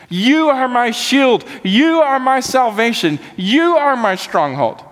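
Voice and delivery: impassioned preaching of scripture; fervent psalm reading. Acted. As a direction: proclaim each line like a declaration of faith. Reads the psalm with rising fervor.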